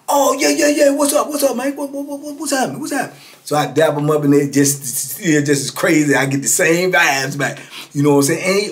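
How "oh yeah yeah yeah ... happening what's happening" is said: high, loud, rapid-fire greetings; a wired old man firing off hellos; excited old-timer. Retold or imitated. Old Cooper Lee greeting Angelo, high and loud.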